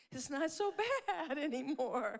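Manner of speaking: loud, high, bursting into a laugh mid-line; laughing relief. Retold or imitated. Telling mourners how Vincent brightened a grim set day, laughing loudly. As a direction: let the relief spill out as laughter. Cheerful guest walks onto a set.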